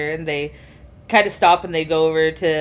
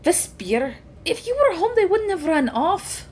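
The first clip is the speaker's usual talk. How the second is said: put-on very high, reproachful older sister; 10 semitones higher than usual. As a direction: blame him gently, then thank, up high. Plays sister.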